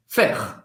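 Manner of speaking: Faire said as one syllable ending on the R, no E; Faire with silent final e. Shown as a demonstration. Teacher to students modelling Faire, no final E. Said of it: "Presta atenção na pronúncia".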